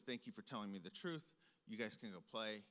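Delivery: low, soft parental dismissal; subdued, even and hushed; calm mom. Retold or imitated. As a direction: imitate a mother quietly closing the matter. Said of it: "in comes my mom very calmly".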